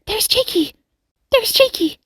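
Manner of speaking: put-on very high-pitched girl's cry; excited child calling out, acted; crying and yelling. A narrator's reading. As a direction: squeal it like a distracted young girl. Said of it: "crying and yelling at once".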